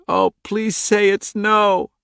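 put-on high, slow, desperate child's cry; screaming plea. A narrator's reading. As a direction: wail the plea in terror. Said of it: "she screamed".

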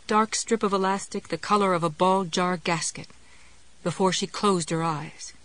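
usual speaking voice

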